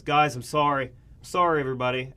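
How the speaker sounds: choked-up student grabbing the mic; mock emotional announcement; emotional student interrupting. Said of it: "the proceedings and says".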